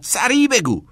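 high, urgent shout; shouted command. A narrator's reading. Teacher rushes pupil to keep composing, shouted command. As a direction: hurry him with a yell. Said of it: "کتینگ داد زد".

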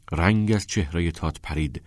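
ordinary delivery, nothing marked

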